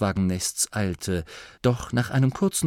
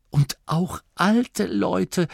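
slow, higher amazed blurt; 9 semitones higher, much slower than usual. A narrator's reading. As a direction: gasp it out in wonder. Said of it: "stieß der Wicht hervor".

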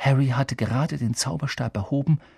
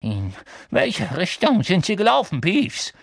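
higher, urgent interrogating voice; Filch questioning; 8 semitones higher, 3 dB louder than usual. A narrator's reading. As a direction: demand an answer as a harried caretaker. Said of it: "hörten sie Filch fragen".

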